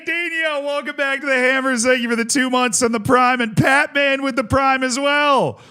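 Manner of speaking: high, bright hype-man greeting; excited welcome. Real, not acted. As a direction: pump up the new subs. Streamer welcoming new subscribers.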